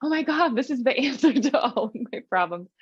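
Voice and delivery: high, laughing gasp of discovery; excited realization. Retold or imitated. Reading the chapter, thrilled at finding the answer, high and laughing. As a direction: gush like you just found the answer, laughing. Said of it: "I was just like".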